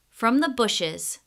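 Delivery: usual speaking voice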